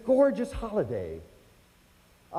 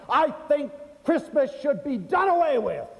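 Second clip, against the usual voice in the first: higher, indignant verdict; a touch of disgust; 10 semitones higher, 4 dB louder than usual. Retold or imitated. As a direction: declare it like the last straw. Shopper's final complaint.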